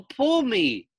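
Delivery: high coaxing; the pigtail pleading again; little pigtail voice. Acted. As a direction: tempt him, short and sweet. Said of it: "said the pigtail".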